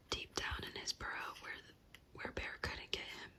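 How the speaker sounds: ordinary delivery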